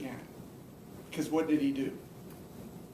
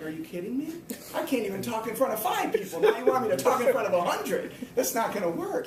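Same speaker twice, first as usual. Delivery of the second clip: retold disbelief; 7 semitones higher, 4 dB louder than usual. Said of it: "I'm like".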